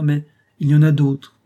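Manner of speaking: put-on a self-pitying old man raising his voice; lamenting shrill cry. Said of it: "d'une voix lamentable et criarde".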